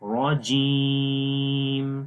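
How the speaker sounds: heavy را, then جیم held very long; lengthened jeem. Shown as a demonstration. Teacher showing where to lengthen, جیم drawn out long. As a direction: stretch the 'ee' of جیم slowly. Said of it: "یہاں پر جیم کو لمبا کریں گے".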